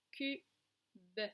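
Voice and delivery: "Cube" broken into cu... be; two separate beats, final e sounded; two syllables. Shown as a demonstration. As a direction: say cu, pause, then beuh. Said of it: "Deux syllabes".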